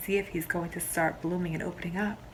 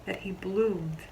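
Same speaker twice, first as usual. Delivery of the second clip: usual speaking voice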